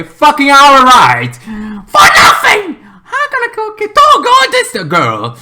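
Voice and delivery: high, loud, slow cry-shout; desperate outburst. Retold or imitated. Retelling to viewers his resolve to reach the girl, a loud wailing shout. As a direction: wail it like nothing will stop you. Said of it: "I have like".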